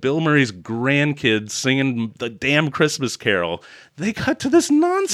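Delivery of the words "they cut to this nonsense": much higher mock outrage; incredulous. Real, not acted. Ranting to co-host about the cut, high incredulous. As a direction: squeal it in disbelief.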